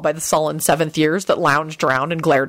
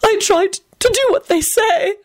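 miserable whisper. A narrator's reading. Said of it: "Lady Fanshawe whispered miserably".

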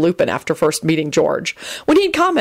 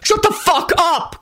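slow, high-pitched angry command; growled anger; 13 semitones higher, slower than usual. A narrator's reading. Quentin telling George off, angry growl. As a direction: silence him with drawn-out anger. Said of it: "Quentin growled".

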